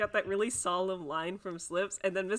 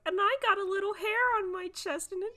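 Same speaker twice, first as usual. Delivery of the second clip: 1 mock kid voice; 13 semitones higher, 4 dB louder than usual. Kid boasting about chest hair.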